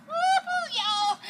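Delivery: high, loud joyful whoop; a happy holler about good eating; delighted whoop. Acted. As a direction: cheer it like the food's on the table. Delighted at the cooking.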